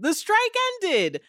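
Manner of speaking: high-pitched, drawn-out jubilant cry; excited announcement. Real, not acted. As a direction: celebrate the news, pitch way up and slow. Celebrating news of the strike ending.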